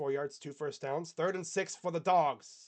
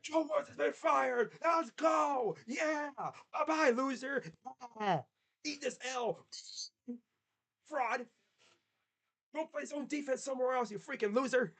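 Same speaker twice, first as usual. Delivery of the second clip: high-pitched, gleeful taunting rant; gloating celebration; 8 semitones higher than usual. Pretend. Fan celebrating the coach's firing, gloating high taunts. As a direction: crow over the fired coordinator like a victory lap. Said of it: "And I'll be like".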